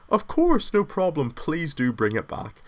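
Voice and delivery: high, eager people-pleaser voice; agreeable pushover. Retold or imitated. He answers the smicks, high and eagerly obliging. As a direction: sound overly agreeable and obliging. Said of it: "me being an agreeable little bitch".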